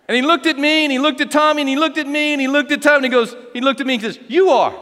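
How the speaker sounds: loud, high comic build-up; animated storytelling. Real, not acted. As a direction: spin out the suspense to the punchline. Builds suspense in anecdote.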